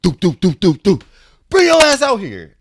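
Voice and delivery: high, loud, angry calling out; furious aunt yelling. Retold or imitated. Aunt at the door yelling for her nephew, loud and angry. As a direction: bang on the door and order him out.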